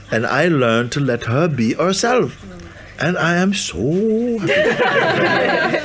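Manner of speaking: high, slow, emphatic joy; overjoyed sadhu. Retold or imitated. Sadhu praising the wife who lets him be himself, overjoyed. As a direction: draw out each phrase, soaring on a long "so".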